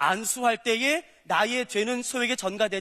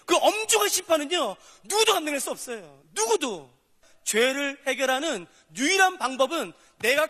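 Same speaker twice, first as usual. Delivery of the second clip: fervent preaching; 5 semitones higher, 3 dB louder than usual. Acted. Preaching at full force.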